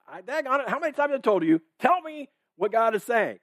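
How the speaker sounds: high-pitched mock exasperation; fed-up king. King demanding the prophet tell God's word, exasperated.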